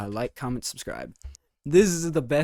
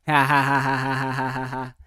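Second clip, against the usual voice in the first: evil laugh; 4 dB louder, faster than usual. Pretend. Gloating after "you can't stop me.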